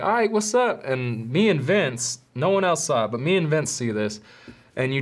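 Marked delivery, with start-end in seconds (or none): 0.0-0.8 squaring up. Retold or imitated. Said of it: "he's walking up like".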